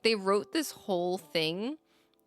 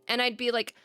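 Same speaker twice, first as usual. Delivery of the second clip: usual speaking voice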